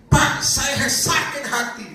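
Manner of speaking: slow, high, plaintive mock voice; lightly voiced complaining woman; quoted hurt woman. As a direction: play the heartbroken girl. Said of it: "Dia ngomong begini".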